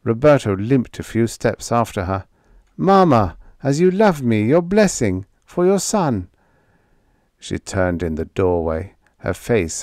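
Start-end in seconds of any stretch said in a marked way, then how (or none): 2.8-6.3 high, loud pleading. A narrator's reading. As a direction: beg her for the blessing. Son begging mother's blessing.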